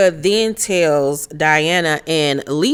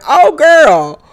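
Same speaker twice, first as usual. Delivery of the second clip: higher, louder gushing hello; mock fake greeting; 8 semitones higher, 7 dB louder, much slower than usual. Vlogger mocking a friendly hello to Erica, higher and louder. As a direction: gush it out like a phony friend. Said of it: "I'm like".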